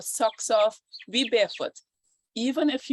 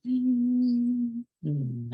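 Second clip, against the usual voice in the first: throat breath; 3 semitones higher than usual. Shown as a demonstration. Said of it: "let's try some Ujjayi breath".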